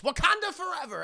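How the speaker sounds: high-pitched slogan, swooping up; mock rallying cry. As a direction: chant it high like a silly battle cry. Character shouts a slogan.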